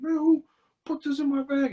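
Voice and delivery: high-pitched indignant outburst; high, put-on complaint; mock anger. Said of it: "I guess he was hot at first".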